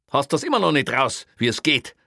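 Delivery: high, loud mocking call; loud taunt. Policeman mocks the burglar for getting caught, loud and jeering. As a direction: keep needling him at full voice.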